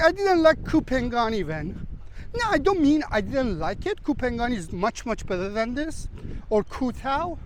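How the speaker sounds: ordinary talk, nothing marked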